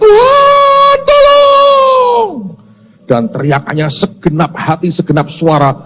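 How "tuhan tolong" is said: high, loud cry for help; desperate cry. Retold or imitated. Man hanging from a root screams to God, high loud wail. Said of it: "Dia teriak".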